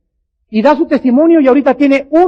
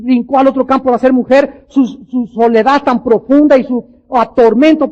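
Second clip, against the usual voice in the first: ordinary delivery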